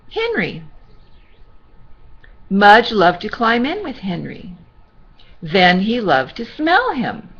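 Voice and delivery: gentle storybook reading voice; sweet old lady. Acted. Reading to children.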